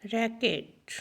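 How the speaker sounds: रैकेट in two clear beats, "rack-et", softly; syllables sounded out. Shown as a demonstration. Teacher helping pupils find syllables, रैकेट sounded out softly. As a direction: let both vowels of रैकेट be heard. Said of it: "syllables find करते जाइए".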